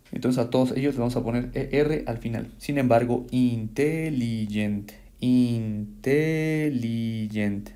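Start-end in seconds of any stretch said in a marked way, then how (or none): 3.3-4.9 slow, stretched-out "intelligent" in syllables. Shown as a demonstration. Teacher counting syllables for students, "intelligent" slowly syllable by syllable. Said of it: "Ya son cuatro". 5.2-7.7 very slow "intelligent", each syllable held long. Shown as a demonstration. Teacher proving it is a long adjective, "intelligent" very slowly by syllables. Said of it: "Ya son cuatro".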